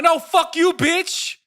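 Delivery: high, loud furious retort; explosive shouted comeback; yelled. Retold or imitated. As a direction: yell the last word in the fight. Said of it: "I legit yelled that".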